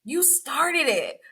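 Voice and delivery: very high, incredulous pitch; high incredulous you started it; indignant rebuke. Retold or imitated. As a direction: squeal it in disbelief. Retold indignant classroom rebuke.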